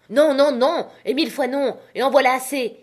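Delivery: high, loud furious refusal; shouting. A narrator's reading. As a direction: bellow it, refusing outright. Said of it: "cria-t-il".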